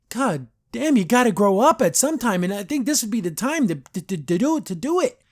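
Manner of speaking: high-pitched, fed-up outburst; exasperated rant. Real, not acted. Reviewer scolds Luz to grow up, exasperated.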